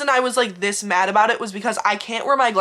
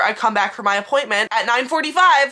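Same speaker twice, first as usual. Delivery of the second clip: ordinary talk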